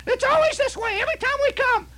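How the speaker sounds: very high, fast, screechy impression of a furious aunt; hollering aunt. Aunt hollering at her husband to leave, high shrieked fury. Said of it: "she would holler".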